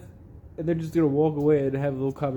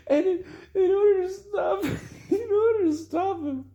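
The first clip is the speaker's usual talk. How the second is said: high-pitched, helpless laughing through the words; cracking up. Real, not acted. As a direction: try to get it out while laughing. Cracking up while telling the story.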